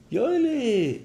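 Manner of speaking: high-pitched drawn-out cry; calling out. Retold or imitated. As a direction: call to a friend for help. Teller calling out to his friend. (הויך אויסרופן)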